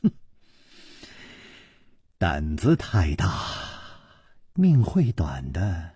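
low, menacing scorn; icy, contemptuous threat; cold sneer. A narrator's reading. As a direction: warn him with a cold snort, then low and slowing. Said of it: "冷笑着说".

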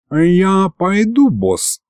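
slow, high, wounded, dropping at the end; offended. A narrator's reading. Offended demon gets up to leave, sulky. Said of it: "Бес обиженно поднялся".

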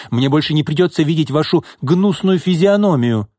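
high, venomous parting insult; spiteful cry. A narrator's reading. Fired secretary insults her boss, spiteful cry. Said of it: "злобно крикнула Нина".

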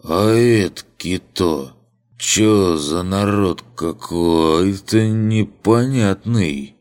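put-on slow slurred drunk voice; cackling toothless ranting. Drunk asks about strangers.

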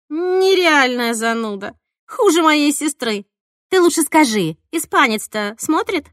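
loud, high complaint, drawn out at first; brash teasing exasperation; exasperated friend. A narrator's reading. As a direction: groan at your killjoy friend, then press eagerly. Exasperated friend, then eager question.

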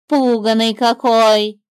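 the bird mocking the frightened snake. A narrator's reading. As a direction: call it out like a cheeky bird. Bird's voice.

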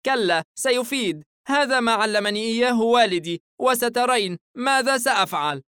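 put-on high, loud, stubborn boy; childish indignation, pitched high; indignant child. A narrator's reading. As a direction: insist like an upset little boy. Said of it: "الطفل باستياء يضرب قدمه".

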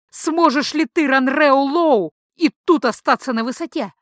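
loud, high raving command; wild prophetic outburst; frenzied shout. Acted. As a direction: shout like a seer seized by a vision. Priestess shrieking her prophecy.

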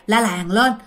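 ordinary delivery, nothing marked

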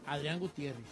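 ordinary delivery

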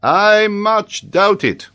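slow, loud, high-pitched disbelief; emphatic doubt. Acted. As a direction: dispute it with drawn-out words. Paganel disputes the child's answer.

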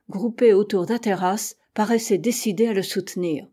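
plain reading voice

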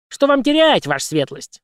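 whistling birdlike puppet; cartoon bird voice. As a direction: chirp it like a bird. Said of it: "свистящим птичьим голосом".

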